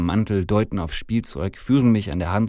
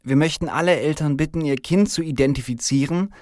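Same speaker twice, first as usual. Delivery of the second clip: high, raised official voice; policewoman addressing crowd; 8 semitones higher, 5 dB louder than usual. Acted. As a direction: address the parents as a female officer. Policewoman addresses the gathered parents.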